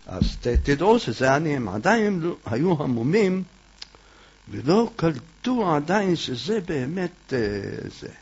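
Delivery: normal talking voice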